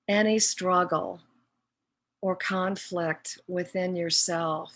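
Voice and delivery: slow, measured meditation guide with long pauses; guided meditation. Real, not acted. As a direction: guide them inward phrase by phrase, leaving long silences.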